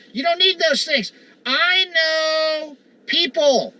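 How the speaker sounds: driving, emphatic pulpit hammering; fiery preaching. Real, not acted. Preacher urging his flock to repent, hammering each line. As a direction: preach it hard at the congregation.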